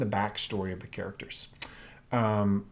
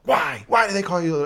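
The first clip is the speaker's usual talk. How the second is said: accusatory tone; 6 semitones higher, 5 dB louder than usual. Retold or imitated. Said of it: "her tone is just like".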